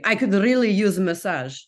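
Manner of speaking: imitating a Russian man's English; mock Russian-accented English; thick Russian accent. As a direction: put on a Russian accent, retelling his words. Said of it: "I'll do the accent for you".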